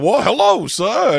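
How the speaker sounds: high, loud, hammy welcoming host voice; put-on character actor voice. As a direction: greet him like an overeager costumed actor. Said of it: "You don't have to do the fucking voice".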